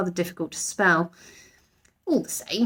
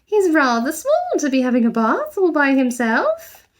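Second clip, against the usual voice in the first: higher, anxious mother voice; Mrs Brown worried; 7 semitones higher, 4 dB louder than usual. A narrator's reading. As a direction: fret over him gently. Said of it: "worried expression".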